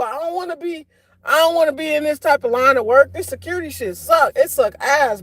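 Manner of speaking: ordinary talk, nothing marked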